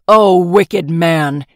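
very loud and slow, booming rage; furious shout. Acted. Emperor to the swineherd, furious roar. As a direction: roar it at the villain. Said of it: "he shouted".

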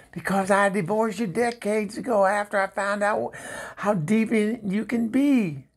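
a touch of high-pitched indignation; indignant. Acted. Indignant rebuke of ex-husband on phone.

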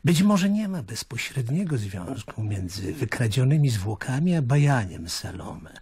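ordinary talk

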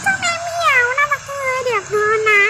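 high sweet voice for a cute cat; cutesy cooing. Acted. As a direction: coo at the kitty in a high voice.

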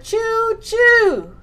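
high, loud choo choo; train whistle choo. Acted. Teacher to toddler, calling choo choo like a train whistle.

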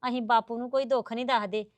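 soft, low, placating denial; calm and conciliatory; soothing. Acted. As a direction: reassure her gently, voice dropped. Soothing the accusing mother-in-law.

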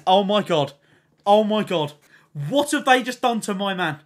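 ordinary talk

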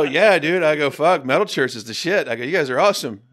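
loud, high gushing fan; excited fan. Retold or imitated. Fan meeting his metal hero, loud and high.

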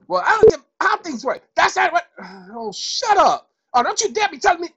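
mock angry retort. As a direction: snap back like an offended caller. Imagined angry listener retorts.

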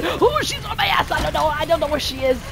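high loud panicked cries; panic, she's right behind you; being chased. Real, not acted. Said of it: "I'm scared".

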